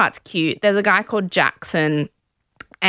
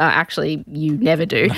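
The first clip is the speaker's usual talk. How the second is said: ordinary delivery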